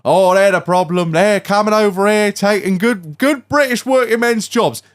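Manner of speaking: high, mock-indignant bigot rant. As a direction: parody an angry old bigot. Mocking old xenophobes.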